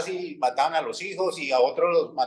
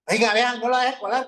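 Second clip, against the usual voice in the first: raised, higher protest at the cutter; indignant call-out; 6 semitones higher than usual. Retold or imitated. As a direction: call her out sharply so the whole line hears. Retold line quarrel at the bank.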